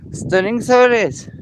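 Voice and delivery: loud, high delighted exclaim; excited. Real, not acted. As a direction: burst out in delight. Admiring the view again.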